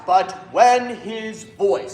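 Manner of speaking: slow "But when his voice", weight on each word; emphasized words. Shown as a demonstration. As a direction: stretch and stress "when" and "voice". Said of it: "words you need to emphasize".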